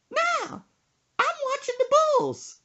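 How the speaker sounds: put-on very high, loud old-lady squawk; caricatured grandma in a piercing falsetto. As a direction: holler back like an irritated grandma. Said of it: "She said".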